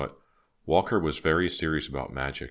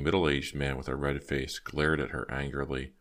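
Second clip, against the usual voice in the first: usual speaking voice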